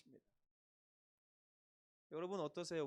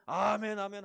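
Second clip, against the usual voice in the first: loud, higher mock amen; 4 semitones higher, 11 dB louder than usual. Pastor mimicking congregation's showy amen, loud. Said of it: "옆에서 뭐라고 합니까".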